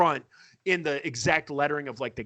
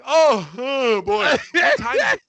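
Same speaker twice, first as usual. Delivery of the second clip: mock dismay; 8 semitones higher, 8 dB louder than usual. Group dreads more partying.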